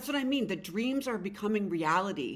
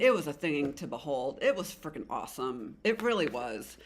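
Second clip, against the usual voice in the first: usual speaking voice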